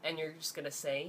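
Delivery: normal talking voice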